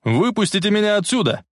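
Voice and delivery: high-pitched plea; trapped shout. A narrator's reading. Boy locked in car trunk, high acted shout. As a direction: cry out high to be let out. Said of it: "кричал".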